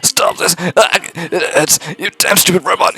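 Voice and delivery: high, strained yell at the robot; a beaten thug snarling at the robot; pleading thug. Acted. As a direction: cry out in pain and spite. Voicing the beaten man yelling.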